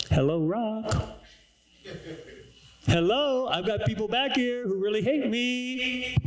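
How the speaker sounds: hammed-up Moses hollering at a rock; Moses calling the rock. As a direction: call up to the stone like it might answer.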